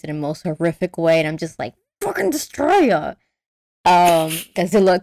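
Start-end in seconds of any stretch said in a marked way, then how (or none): 2.0-3.1 high-pitched, hammed-up outrage; mock exasperation. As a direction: curse the villain in playful dismay. Acted outrage at Junior's death.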